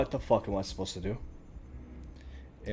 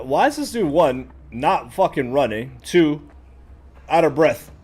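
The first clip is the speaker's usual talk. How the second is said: much higher, louder frustrated yell at the character; real exasperated shout climbing higher; furious yelling; 6 semitones higher, 9 dB louder than usual. Real, not acted. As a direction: shout at him for being out of breath. Yelling at sluggish game character.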